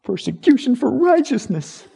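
hammed-up persecuted sigh; mock martyr. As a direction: play the wounded martyr with a laugh. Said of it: "Don't go, Oh".

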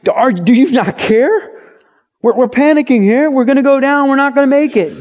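high, loud frantic mock plea; panicked plea. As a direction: beg him awake in mock panic. Disciples waking Jesus in panic.

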